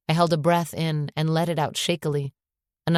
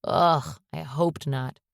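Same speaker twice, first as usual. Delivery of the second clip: ordinary delivery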